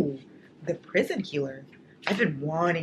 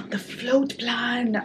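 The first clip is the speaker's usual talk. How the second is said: sharp gasp, then the title breathed out slowly and high; gasping excitement; 7 semitones higher, much slower than usual. Real, not acted. Vlogger gasps at a book from a fan.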